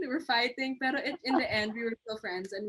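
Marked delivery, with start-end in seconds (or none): none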